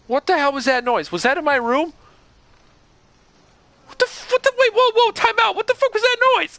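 very high panicked cartoon squeal; accidental Goofy voice. Real, not acted. Scared gamer unknowingly doing Goofy voice. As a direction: freak out in a goofy falsetto. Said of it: "can you please do the Goofy impression".

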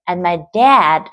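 "dad" punched; stress on dad. Shown as a demonstration. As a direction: hit "dad". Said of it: "từ dad là một cái từ được nhấn". (nhấn mạnh "dad")

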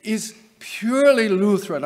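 mock Calvin in a bad French accent; hammed-up French accent, deliberate phrasing; terrible French accent. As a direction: put on a badly faked French accent. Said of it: "I'm sorry, a terrible French accent".

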